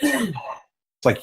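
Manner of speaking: ordinary talk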